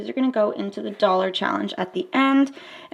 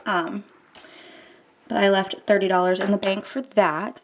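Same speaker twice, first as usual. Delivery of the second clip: ordinary talk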